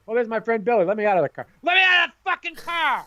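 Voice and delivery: mock an embarrassed kid desperate to escape the car; exasperated yell. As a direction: escalate to a high, loud yell. Acting embarrassed kid being dropped off.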